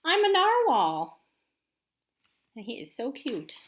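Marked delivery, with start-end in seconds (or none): 0.0-1.2 high, loud and slow I'm a narwhal; proud narwhal. Acted. Teacher reading the narwhal's proud line to kids, high and loud. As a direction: announce it proudly as the little narwhal. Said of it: "reading the narwhal's line".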